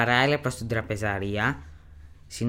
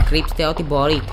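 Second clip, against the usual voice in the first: louder, urgent cry to the monks; panicked shout; 3 semitones higher, 6 dB louder than usual. Acted. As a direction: shout the warning as the door gives way. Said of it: "φώναζε ένας".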